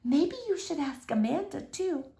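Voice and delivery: soft hushed suggestion; whispered. Acted. Miss Franny hushing a suggestion in the library, soft. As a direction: keep it down in the library. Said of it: "and whispered".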